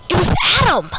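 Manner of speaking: high, loud announcement; dramatic reveal. A narrator's reading. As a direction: announce the surprise.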